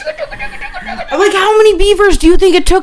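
finding the beaver butt juice; laughing disbelief. Retold or imitated. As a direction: retell the discovery in giggling shock. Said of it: "I was like".

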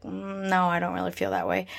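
soft, flat dismissive mock reply; quietly imitating others. Vlogger quoting people who don't feel it, quiet and dismissive. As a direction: quietly mimic an unbothered person. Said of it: "people who are like".

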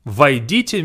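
normal talking voice

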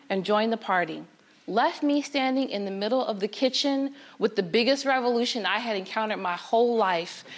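ordinary delivery

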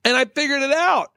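high-pitched, emphatic retold revelation; excited friend. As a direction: imitate a friend proudly announcing his theory. Acted quote of excited friend.

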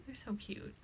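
barely voiced, hushed; hushed confession. Real, not acted. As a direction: let it out like a guilty secret. Confessing a love of rats.

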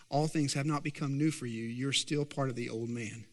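low, soft, grave preaching; solemn gospel appeal. Real, not acted.

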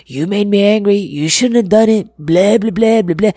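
a hammed-up angry parent who trails off into blah blah; blaming parent. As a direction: scold the child and dump the blame on them. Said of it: "keep excusing bad behavior".

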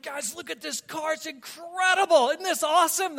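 put-on over-the-top teen pride; thrilled bragging. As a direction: gush to your buddies about the car. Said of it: "I'm like".

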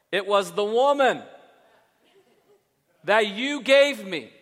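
high, loud complaining whine, blaming everyone; whiny Adam. Acted. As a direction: play Adam as a petulant, aggrieved man-child, pushing the blame onto God. Adam whining, blaming God.

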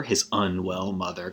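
soft muttered echo of "his unwell mother"; quiet repeat. Shown as a demonstration. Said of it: "he takes care of his unwell mother".

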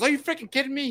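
high put-on indignant voice; mocking the angry grandpa. Grandpa yelling at fishermen on his dock. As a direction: squeal it like an outraged old man. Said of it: "And he goes".

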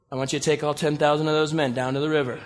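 ordinary talk

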